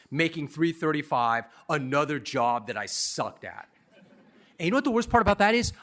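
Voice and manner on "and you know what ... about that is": fast, high and loud; excited outburst. Real, not acted. Storyteller bursts out to the audience, fast and loud. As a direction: blurt it out, much higher.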